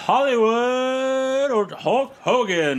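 high, slow ring-announcer delivery. Retold or imitated. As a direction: draw out the name like an announcer. Announcer-style name drawl.

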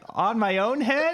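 high, slow puzzled question; confused kid voice. Retold or imitated. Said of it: "He's like".

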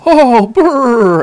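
a sudden chill hits you; shivering brr. Acted. Sora startled by cold, loud and shivery. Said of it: "Sora says".

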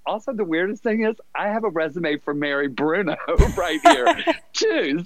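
high, quick, laughing through the words; laughing recruiter. Retold or imitated. Recruiter telling the narrator she holds both resumes, laughing. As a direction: giggle out the coincidence, pitched well up. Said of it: "she was like".

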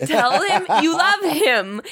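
high, laughing imitation of a pushy mom; laughing mock mom. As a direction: laugh through the nagging mom line. Mom nagging, laughing while retelling.

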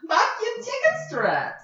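high, slow screamed quote; screaming. Retold or imitated. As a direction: scream it out the window. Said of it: "He's just screaming it out the".

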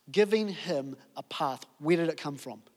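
normal talking voice

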